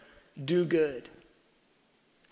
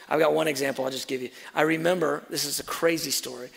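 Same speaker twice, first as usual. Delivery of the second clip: ordinary talk, nothing marked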